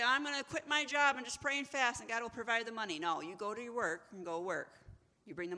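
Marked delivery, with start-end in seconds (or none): 0.0-3.0 high, loud mimicry of a naive believer; imitating an overeager believer, voice raised; mock pious vow. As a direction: proclaim it with hammed-up confidence.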